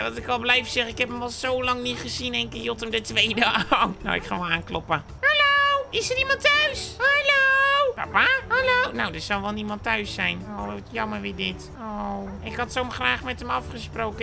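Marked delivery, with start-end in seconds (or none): 5.2-8.9 high squeaky calls at the door; calling out. Acted. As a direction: call into the house, very high. Knocking, calling for dad.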